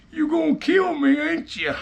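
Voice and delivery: a hurt man half-begging for his life; beaten uncle. Retold or imitated.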